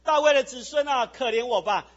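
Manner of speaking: loud acted cry for mercy. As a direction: call out over those hushing you. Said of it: "他却越发大声喊着说".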